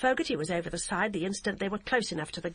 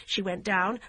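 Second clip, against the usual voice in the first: plain reading voice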